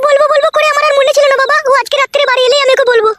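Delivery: put-on high-pitched, deferential young wife voice; put-on high wifely voice, politely worried; respectful daughter-in-law. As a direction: explain yourself to father-in-law in a high voice.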